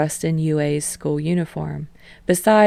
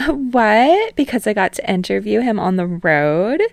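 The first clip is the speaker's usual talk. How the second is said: put-on playful TV host calming the boys, then prying; teasing talk show host; 4 semitones higher, 4 dB louder than usual. Host Utsushimi's playful reply.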